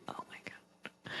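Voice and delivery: a hushed, breathy aside under his breath; whispered oh my God. Retold or imitated. Husband mutters in disbelief as wife demands praise, whispered. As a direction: imitate a soft whisper, almost no voice.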